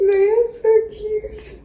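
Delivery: high, slow gushing; high delighted coo. Real, not acted. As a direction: coo at the cute ghosts. Gushing over finished ghosts.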